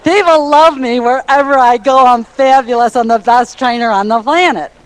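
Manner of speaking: hammed-up self-praise, pleased with yourself; mock bragging. Shown as a demonstration. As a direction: brag shamelessly with a big smile. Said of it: "I know I sound".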